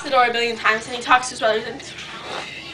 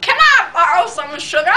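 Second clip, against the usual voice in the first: put-on friend voice, pitched up; imitating friend's voice; 4 semitones higher than usual. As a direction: mimic your friend asking sweetly high. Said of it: "She really talks like this".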